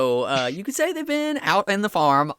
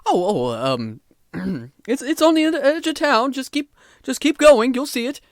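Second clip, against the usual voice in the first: flustered townsman; 5 semitones higher, 3 dB louder than usual. Acted. GM voices nervous townsman NPC.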